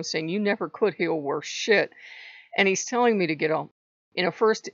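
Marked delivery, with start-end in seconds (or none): none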